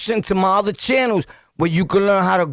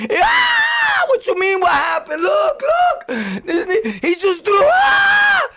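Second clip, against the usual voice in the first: hysterical yelling; slower than usual. Retold or imitated. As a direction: blurt it out in a screaming panic. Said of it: "Every time she asked me what happened".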